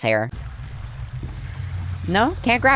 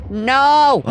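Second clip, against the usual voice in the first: high, loud "no!"; frustrated shout; 8 semitones higher, 5 dB louder than usual. Real, not acted. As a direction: yell at the missed shot. Missing the dart.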